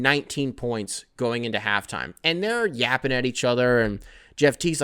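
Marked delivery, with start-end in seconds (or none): none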